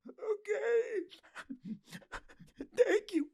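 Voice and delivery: high-pitched exaggerated sobbing imitation; mock crying. Host imitates tearful kid grateful for second interview, crying. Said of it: "'Cause he was crying".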